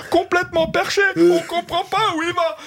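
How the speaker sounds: high-pitched mock complaint; mocking online commenters. Comic reading critics' comments against him, high mocking gripe. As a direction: mimic a griping listener, voice way up. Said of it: "les commentaires sur Radio France".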